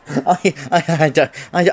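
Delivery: words tumbling out in a panic; flustered stammer. Acted. Flustered stammering after the misunderstanding.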